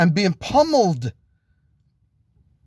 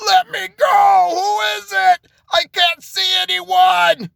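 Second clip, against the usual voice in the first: high screamed cries, louder and far above speaking pitch; panicked yelling; 4 dB louder than usual. Acted. Ron picked up by his neck, yelling in high panic. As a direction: yell desperately to be released. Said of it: "and he's yelling".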